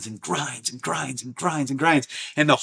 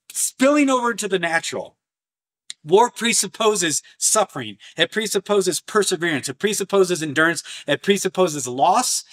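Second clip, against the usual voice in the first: ordinary talk, nothing marked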